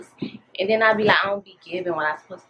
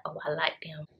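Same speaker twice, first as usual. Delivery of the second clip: whispered reply; breathy hushed words; whispering; 2 semitones lower, 11 dB quieter than usual. Retold or imitated. Best friend's quiet reaction.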